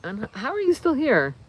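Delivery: ordinary delivery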